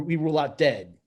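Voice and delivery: ordinary talk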